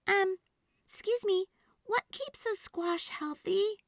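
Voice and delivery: put-on polite little girl. A narrator's reading. Girl asking farmer, high and polite. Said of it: "Sophie asked".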